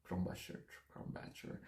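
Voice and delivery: "Krombacher" whispered twice under the breath, testing the sounds; unsure pronunciation tries. Shown as a demonstration. Vlogger unsure how to pronounce beer name, whispering tries. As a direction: mutter "Krombacher" to yourself, trying it out. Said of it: "I really don't know, guys, how to pronounce this one".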